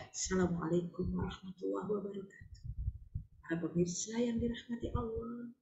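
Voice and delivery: soft gentle preacher. Retold or imitated. Imitating gentle TVRI preacher greeting viewers softly. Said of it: "Suaranya lembut".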